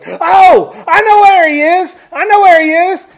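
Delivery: high, full-volume shout; yelled realisation. Retold or imitated. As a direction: imitate a frantic man suddenly remembering. Retelling the man's yelled sudden realisation.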